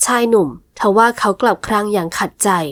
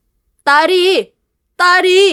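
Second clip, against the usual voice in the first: high, loud call of her name, dragged out slowly; shouting her name; 7 semitones higher, 4 dB louder, much slower than usual. Acted. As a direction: yell ตารี as he is thrown out, stretching it. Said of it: "ชายหนุ่มตะโกนเสียงดัง". (ตะโกนเรียกชื่อเสียงดังและลากยาว)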